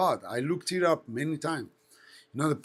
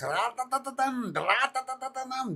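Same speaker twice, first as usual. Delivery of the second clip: higher ra-da-da-dum engine burble; turbo engine imitation; 10 semitones higher than usual. Telling a friend about the rally, mock turbo noise. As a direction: mimic turbos spooling on the apex.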